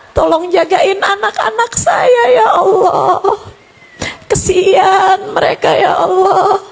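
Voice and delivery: a high sobbing plea, half sung; crying prayer. Retold or imitated. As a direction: beg for the children through tears. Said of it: "Dengan linangan air mata kamu berdoa".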